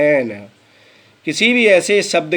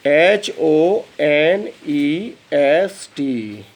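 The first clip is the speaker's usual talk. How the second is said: each letter said very slowly; slow spelling; much slower than usual. Shown as a demonstration. Teacher slowly spells honest for the class. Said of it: "ईमानदार को क्या बोलेंगे?".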